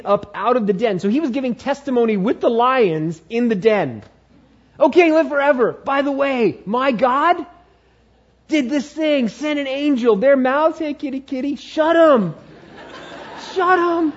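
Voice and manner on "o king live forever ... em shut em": high, bouncy hammed-up Daniel; playful Daniel. Daniel cheerfully telling the king how the lions were shut, hammed-up. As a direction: tell it like a cheeky storyteller.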